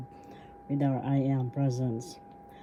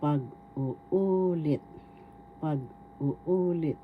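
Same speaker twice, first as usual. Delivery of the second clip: pag-uulit said very slowly, long second "u"; stress on third syllable; much slower than usual. Shown as a demonstration. Teacher showing how we pronounce retell, stress on third syllable. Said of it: "so we pronounce this or bigkas".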